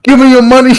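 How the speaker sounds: high, loud robber's demand; thug demand. Acted. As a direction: bark the demand like a petty thug. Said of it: "player acts his robber".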